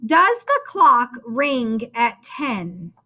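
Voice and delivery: slow, clear dictation of the sentence. Shown as a demonstration. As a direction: read each word slowly for the class to write. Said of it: "we'll say it one more time".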